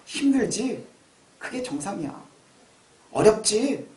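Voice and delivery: gentle question, soft low reassurance; soothe, dropping softly on each answer; comforting voice of the Lord. Acted. The Lord reassuring struggling believers, gentle. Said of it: "주님께서 우리에게 그렇게 말씀하시거든요".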